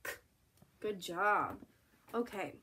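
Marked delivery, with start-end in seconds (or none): 0.0-0.1 "K" as a bare k click, no vowel; just the k sound for "K"; letter sound. Shown as a demonstration. Teacher repeating C and K for the class, bare sound. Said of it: "Say it again".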